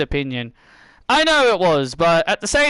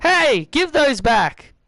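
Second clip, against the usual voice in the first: indignant; 7 semitones higher, slower than usual. Real, not acted. Weapons stolen by boss.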